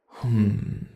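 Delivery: ordinary talk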